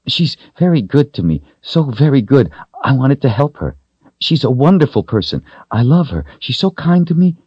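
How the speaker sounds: put-on high, pleading child voice; anxious little girl. A narrator's reading. Girl defending herself to the wizard, anxious pleading. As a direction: gush excuses in a high, nervous voice.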